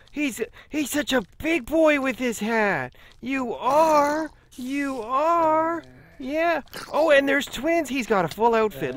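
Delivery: high, loud cooing over something adorable. Real, not acted. Player doting on little creatures in hats, gushing baby talk. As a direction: squeal it like praise for a cute pet.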